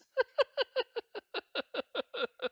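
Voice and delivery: long, high, loud laughing fit; high giggling laugh. Real, not acted. Streamer cracking up at own British accent, high laughter.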